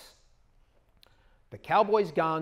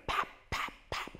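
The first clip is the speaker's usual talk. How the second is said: cap gun noise; 9 dB quieter than usual. Retold or imitated. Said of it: "guns are running around going".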